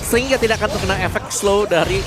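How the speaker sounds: ordinary delivery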